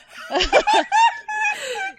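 high loud burst of laughter; big laugh. Real, not acted. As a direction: let the laugh ride up. Table cracks up.

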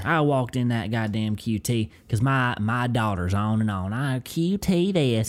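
hammed-up Southern storyteller, running on; Southern accent. Host telling of boiled peanuts, put-on Southern accent. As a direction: spin a country yarn in thick Southern. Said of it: "start leaning into the Southern accent".

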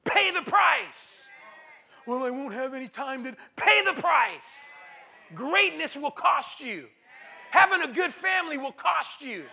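full-force preaching, hammer each line; preaching shout. Acted. Preacher shouting the refrain at the congregation.

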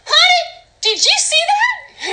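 high-pitched comic alarm, climbing; imitated astonishment. Driver retelling a strange zap to spouse, high mock alarm. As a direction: screech the question in mock astonishment. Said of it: "And I'm like".

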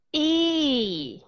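long English "y" held out clearly; teacher demonstrating the long ee vowel; long ee demo. Shown as a demonstration. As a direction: model a clean, drawn-out "y" sound. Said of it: "Nghe cho kỹ".

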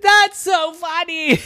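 high, loud squeal breaking into giggles; laughing hard. A narrator's reading. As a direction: crack up as Laffy Kathy. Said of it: "she kept laughing and laughing".

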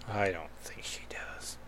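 very soft whisper to oneself; whispered aside. Real, not acted. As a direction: let it drop to a doubtful whisper. Doubtful aside to self.

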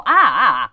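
loud fake crying sounds; mock wailing. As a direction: ham up a sob for the roses. Mock sobbing at the story. (pianto finto, forte)

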